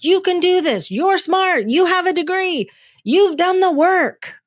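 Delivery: high, loud drill-coach pep talk; coach pep-talk yell. Retold or imitated. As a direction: bark encouragement at yourself like a tough coach. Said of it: "that's my voice".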